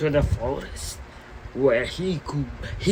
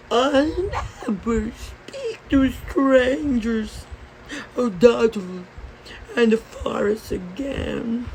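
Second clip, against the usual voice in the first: put-on young girl character; 7 semitones higher than usual. A narrator's reading. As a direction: draw it out earnestly in a high girlish voice. Red's vow to grandmama, acted.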